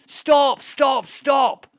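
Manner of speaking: high, loud shout of stop; retold shout. Yelling at the player who is shooting at him. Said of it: "I went".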